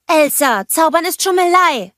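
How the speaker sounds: high, loud, indignant scolding. A narrator's reading. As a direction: shout an accusation of cheating. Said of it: "schimpfte Anna". (laut und hoch schimpfend)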